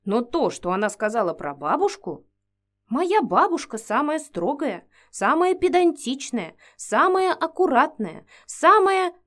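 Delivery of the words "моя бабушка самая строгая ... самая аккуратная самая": building up. Real, not acted. Building list about grandmother.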